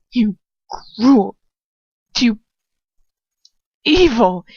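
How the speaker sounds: loud, broken accusation; loud, tearful insult; tearful outburst. Acted. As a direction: spit it out through tears. She lashes out in tears.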